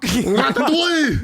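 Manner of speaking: put-on drawn-out hammy French accent. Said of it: "I actually don't know anything about France".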